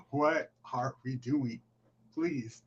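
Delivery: slow, quiet "What are we doing?"; hushed, deliberate "What are we doing?"; slow exasperation. Real, not acted. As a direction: draw out "What are we doing?" in weary disbelief. Repeats the question, more deliberately.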